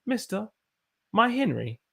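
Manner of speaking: put-on British accent; chatty mock-British accent, mostly quick. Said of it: "in my British accent".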